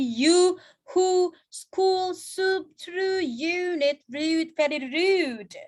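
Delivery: "you who school soup" each with a long, slow U; long U sound. Shown as a demonstration. Teacher reading the example list, each U held long. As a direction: hold every oo in "you, who, school, rude". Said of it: "Itu U-nya panjang".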